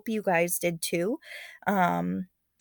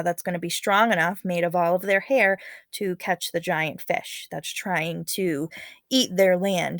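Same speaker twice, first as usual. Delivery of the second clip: usual speaking voice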